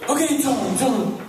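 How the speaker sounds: very high, loud hammed-up panic; mock terror. Friend hearing his captor outside the bathroom, imitated panic. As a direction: squeal it urgently, as if trapped.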